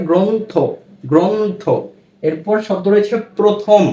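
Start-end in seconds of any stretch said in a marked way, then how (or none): 0.1-1.6 গ্রন্থ said as "gronth", o after g; গ্রন্থ with a clear o-sound; model reading. Shown as a demonstration. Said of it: "কি হয়?". (গ্রন্থ শব্দে ও-কারান্ত উচ্চারণ)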